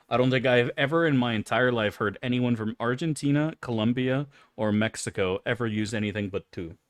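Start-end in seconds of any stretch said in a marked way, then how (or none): none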